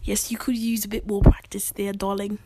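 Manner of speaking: hammed-up English accent; mock British accent. Co-host teasing about accent practice, mock British accent. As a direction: tease in a put-on British accent. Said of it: "practice my British accent".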